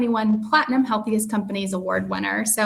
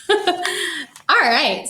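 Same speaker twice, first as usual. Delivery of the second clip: laughing; 6 semitones higher than usual. Real, not acted. Laughing after the dance break.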